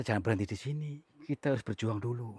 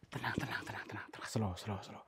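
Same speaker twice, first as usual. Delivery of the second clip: soft whispered calming; whispered soothing; 8 dB quieter than usual. Real, not acted. Ghost hunter whispering to calm the angry spirit. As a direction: hush it down gently.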